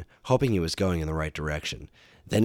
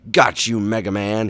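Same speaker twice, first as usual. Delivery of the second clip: loud gloating villain; hammed-up triumphant gloat; 3 semitones higher, 7 dB louder than usual. A narrator's reading. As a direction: crow over the beaten hero. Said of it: "Bubble Man gloated".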